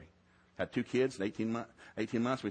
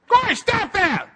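much higher, louder, slow scolding yell at Corey; mock yell; 15 dB louder, slower than usual. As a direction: shout her name like a fed-up referee. Referee yelling at Corey.

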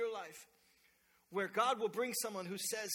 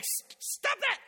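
put-on sharp high yell at a child; alarmed dad snapping at the back seat; startled parent yell; 7 dB louder, slower than usual. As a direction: stop her fast, a jump in pitch. Parent yelling at daughter.

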